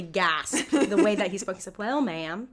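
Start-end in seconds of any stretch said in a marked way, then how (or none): 1.8-2.5 a hint of a Southern cop saying well, ma'am; Southern accent. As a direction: play the polite Southern officer. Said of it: "I can't stop talking like this because I love this accent".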